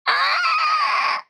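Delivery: high, loud dinosaur screech. Pretend. Doing the dinosaur.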